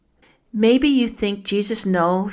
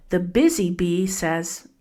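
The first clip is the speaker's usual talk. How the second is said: ordinary talk, nothing marked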